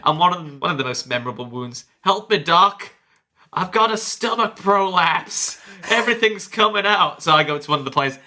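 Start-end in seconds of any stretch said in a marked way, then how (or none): 2.0-7.2 high-pitched mock casualty plea; wounded patient. Patient begs the medic, high mock distress. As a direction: beg for help, hammed-up and higher. Retold wounded player begging the doctor.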